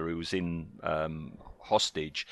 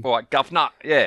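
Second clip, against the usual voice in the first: put-on Cockney accent; 3 semitones higher, 5 dB louder, faster than usual. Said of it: "he develops a Cockney accent".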